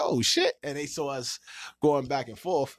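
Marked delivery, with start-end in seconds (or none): 0.0-0.5 high, surprised falsetto imitation; mock friends. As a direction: friends caught off guard by the fight. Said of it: "they said".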